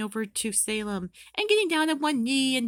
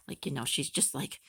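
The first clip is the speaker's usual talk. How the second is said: normal talking voice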